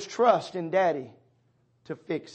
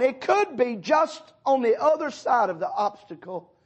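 raised, higher, louder preaching build; fervent preaching; 7 semitones higher, 6 dB louder than usual. Acted. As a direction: push it out like a revival preacher. Preaching at full force.

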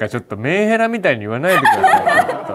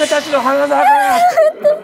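proud shout; 12 semitones higher, 4 dB louder than usual. Acted. Mock proud cheer.

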